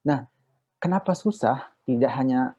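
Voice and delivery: usual speaking voice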